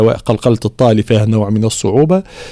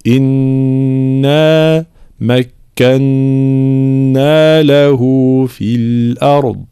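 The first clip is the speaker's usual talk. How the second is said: slow chanted إنا مكنا له في الأرض; slow recitation; much slower than usual. Shown as a demonstration. Teacher models the verse for students. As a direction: recite slowly with full ghunna. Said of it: "ثم مد هنا بمقدار المد الطبيعي".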